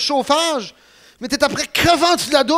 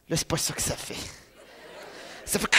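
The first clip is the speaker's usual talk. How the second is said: hushed suspense; 7 semitones lower, 17 dB quieter, faster than usual. Retold or imitated. Building suspense about cold water.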